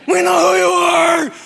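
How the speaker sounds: high, loud shouted demon voice. Acted. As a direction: snarl it as the possessed man. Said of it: "Here's this demon guy".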